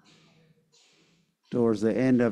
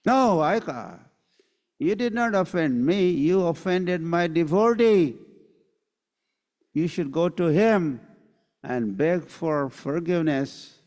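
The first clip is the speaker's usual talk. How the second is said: higher, firm voice of the Lord; stern Lord Vishnu; 6 semitones higher, 4 dB louder than usual. Acted. Vishnu sends Durvasa back to beg forgiveness, higher and firm. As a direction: lay down the law to the sage.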